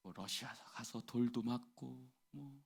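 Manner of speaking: ordinary talk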